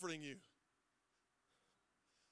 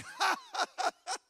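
mock laugh; 11 semitones higher, 9 dB louder than usual. Mocking laugh of the stuff.

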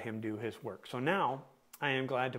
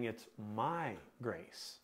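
"my" drawn out slowly, the rest softer; stress on MY; 3 semitones lower, 5 dB quieter, slower than usual. Shown as a demonstration. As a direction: lean long on the word "my". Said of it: "what word you emphasize here".

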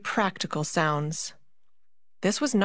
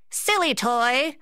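slow, higher, louder sneer; mock the toy with contempt; Mouse King sneer; 6 semitones higher, 3 dB louder than usual. A narrator's reading. Mouse King sneering at the Nutcracker. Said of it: "said the Mouse King".

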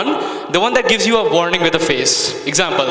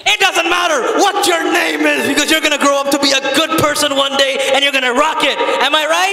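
shouted pep talk; 8 semitones higher than usual. Acted. Comic cheering on a kid in the audience, shouted pep talk. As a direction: holler it like an over-keen motivator.

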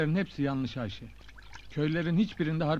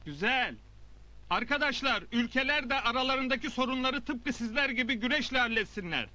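raised oratory to a crowd; public speech; 7 semitones higher than usual. Acted. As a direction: address the village square. Addresses assembled villagers. (kalabalığa hitap eden nutuk)

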